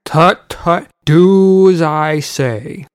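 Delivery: loud, slow pompous order; commanding. A narrator's reading. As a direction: lay down the law, slowly. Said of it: "the alderman commanded".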